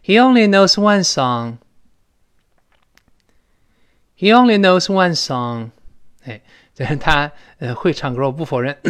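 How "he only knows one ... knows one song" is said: loud, high, slow and clearly spaced sentence; loud model reading. Shown as a demonstration. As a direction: read it out slowly and loudly for the class to repeat. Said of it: "再大声读一下这句话".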